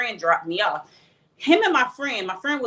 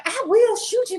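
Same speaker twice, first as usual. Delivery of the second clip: high, strained mock threat; hammed-up high threat from the boyfriend; 13 semitones higher, 4 dB louder than usual. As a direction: voice him high and wound up. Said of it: "And he was like".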